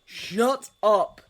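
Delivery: sharp angry shout; growled. A narrator's reading. Holmes snapping at Snape, loud angry growl. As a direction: bark it at him. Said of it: "growled Holmes abruptly".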